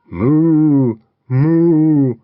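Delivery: loud put-on cow moo. The cow moos to frighten the robbers, loud drawn moo. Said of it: "and the cow mooed".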